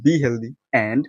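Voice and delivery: slow sign-off. Acted. Vlog sign-off catchphrase.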